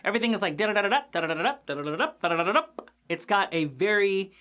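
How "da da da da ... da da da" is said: fast rattled da-da-da; machine-gun the syllables; mock rapid-fire chatter; imitating fast talk. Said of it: "those kids talking super fast".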